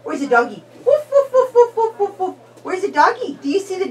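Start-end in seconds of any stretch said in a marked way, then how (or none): 0.8-2.3 high playful barking woof; mock dog barking. Parent to baby in an animal game, high playful woofs. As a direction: bark like a small dog.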